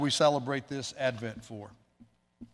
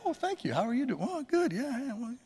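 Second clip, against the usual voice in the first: much higher, softer, pleased voice; surprised stranger replying; 11 semitones higher, 4 dB quieter than usual. Acted. Stranger in line answers a friendly greeting, high and pleased. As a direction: play a flattered stranger, high and light. Acting the other person's reply.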